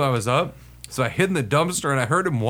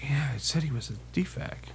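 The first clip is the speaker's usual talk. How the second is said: very soft, musing mutter; imitated thinking aloud; 10 dB quieter than usual. Sergeant puzzling over where the soldier went, quiet self-talk. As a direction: work it out under your breath. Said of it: "I hear him thinking out loud to himself".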